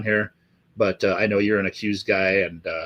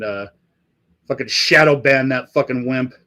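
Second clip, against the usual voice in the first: usual speaking voice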